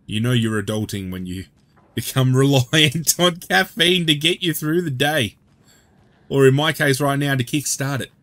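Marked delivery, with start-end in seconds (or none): 2.0-5.4 laughing. Real, not acted. Joking about caffeine dependence.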